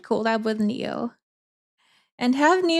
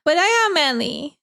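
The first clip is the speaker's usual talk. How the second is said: playful whiny protest, higher at the start; 3 semitones higher, 4 dB louder than usual. Pretend. Streamer answering a viewer who teased his manliness, mock whiny. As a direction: whine it like a mock-offended protest. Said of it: "saying it in a whiny tone".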